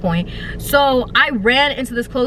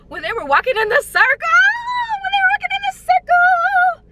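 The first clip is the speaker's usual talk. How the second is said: high and higher panicked wail; rising panic. Retold or imitated. Reliving the circling friends with rising panic. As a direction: climb into panic.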